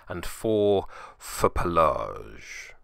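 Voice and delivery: slow, drawn-out Pelage, each syllable of Pelage stretched and relished; savoured name. Shown as a demonstration. Reading the allocation, lingering fondly on Pelage. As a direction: roll Pelage out slowly, enjoying the sound. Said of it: "It's just fun to say".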